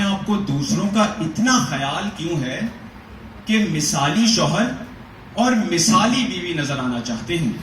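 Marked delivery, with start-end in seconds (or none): none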